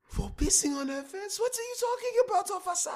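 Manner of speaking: very high, indignant mock rant at a cop; hammed-up comedian impression. Friend playing the comedian arguing with the officer, high indignant mock rant. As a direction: squeal out the outraged foreigner's defense.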